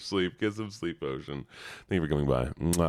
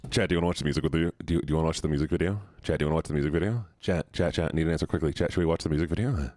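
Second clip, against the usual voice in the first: rapid-fire asking; much faster than usual. Real, not acted. Rapid questions to chat.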